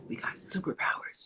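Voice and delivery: hushed, secretive whisper; confiding whisper. Retold or imitated. Mom letting her daughter in on the superpowers, confiding whisper.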